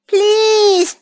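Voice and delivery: high, drawn-out plea; begging. A narrator's reading. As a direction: beg like a storybook crab, stretching the word. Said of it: "begged the crab".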